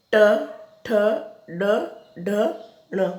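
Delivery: ट, ठ, ड, ढ, ण said one by one with pauses; sounding out consonants. Shown as a demonstration. Teacher reciting retroflex letters to pupils, one at a time. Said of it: "और फिर दूसरा होता है".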